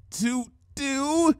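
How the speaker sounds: high frightened cry; terrified cry. A narrator's reading. Changeling crying out in terror at the light. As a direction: voice cracking in terror. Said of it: "cried out in terror".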